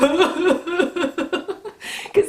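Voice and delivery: high, stuttering imitation sobbing; hammed-up bawling in quick bursts; mock baby sobs. Woman imitates her full on baby sobs, mock bawling. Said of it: "I just started sobbing, like, full on baby sobs".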